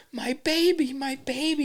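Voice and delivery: high-pitched wail without volume; mock screaming. Imitating the rescued woman calling for her baby, mock scream. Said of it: "She's screaming".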